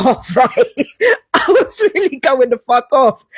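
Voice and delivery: ordinary talk, nothing marked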